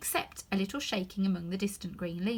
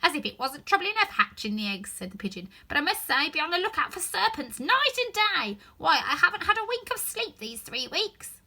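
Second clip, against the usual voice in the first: higher, louder grumbling bird; complaining pigeon; 8 semitones higher, 6 dB louder than usual. Acted. Pigeon grumbling about serpents, higher and louder. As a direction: sigh out your grievance.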